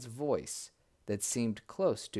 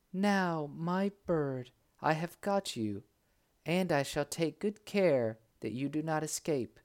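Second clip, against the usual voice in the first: light, high princess voice; bright young lady speaking; 5 semitones higher than usual. A narrator's reading. Princess catches the bird.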